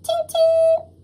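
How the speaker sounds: bright mock train whistle; engine whistle. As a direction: imitate a steam whistle. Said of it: "Gordon whistled".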